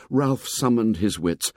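usual speaking voice

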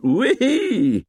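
high, drawn-out whee; long high cry of glee; gleeful whoop. Acted. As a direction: whoop with delight. Boy celebrates the hit.

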